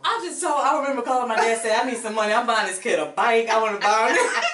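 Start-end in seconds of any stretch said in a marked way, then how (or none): 1.8-4.4 louder, eager asking for money; excited plea. Retold or imitated. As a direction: press dad eagerly for cash. Begging dad for bike money.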